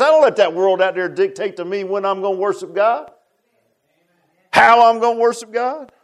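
high, loud preaching cadence; fiery sermon climax; full-force preaching. Acted. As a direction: drive it home to the congregation.

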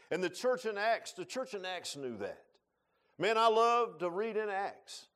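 loud, high preacher's cry, slowing; preaching at full force. Acted. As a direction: proclaim it to the pews. Preaching builds again.

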